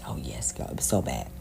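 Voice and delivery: low, hushed admission; under-the-breath real admission; confiding aside. Real, not acted. Confiding aside about drug use.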